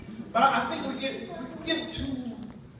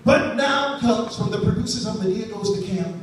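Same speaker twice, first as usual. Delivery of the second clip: slow, louder dramatic announcer; movie trailer voice; 3 semitones lower, 4 dB louder, slower than usual. Pretend. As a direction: sell it like a trailer. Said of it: "I see the trailer now".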